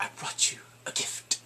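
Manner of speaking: unvoiced, sinister whisper; creepy whispered gift; sly whisper. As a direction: whisper the offer slyly. Euron presenting captives to Cersei.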